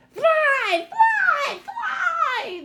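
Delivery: squeaky high troll chant, loud and laughing; a pack of excited little trolls egging her on; clamoring trolls. Acted. Greedy trolls clamoring at the girl to fly, squeaky high and loud. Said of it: "they clamored".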